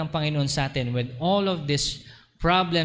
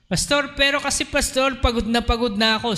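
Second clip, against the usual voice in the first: higher, whiny tired plea; weary congregant; 8 semitones higher than usual. Pretend. Congregant whining to the pastor, higher and exhausted. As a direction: whine like a worn-out church member.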